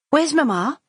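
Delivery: high, loud call through the house. Acted. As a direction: call up the stairs for Mamma. Violet calling out.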